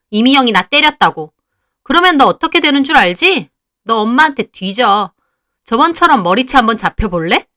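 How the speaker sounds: loud, defiant snarling threats; bully her sister with loud threats; angry shouting sister. Acted. Younger sister threatening her older sister, loud and angry.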